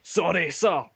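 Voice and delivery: lightly voiced soldiers caught out; sheepish guards.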